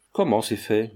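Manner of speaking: ordinary delivery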